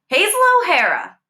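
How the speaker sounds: loud, high furious call of her name; angry shout. A narrator's reading. Teacher shouting at the girl inside the classroom, loud and furious. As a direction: yell her full name in fury.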